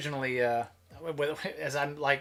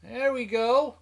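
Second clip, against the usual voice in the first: excited showman; 9 semitones higher, 5 dB louder than usual. Real, not acted. Excited about the zoomed cam.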